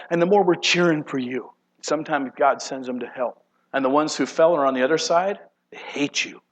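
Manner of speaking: normal talking voice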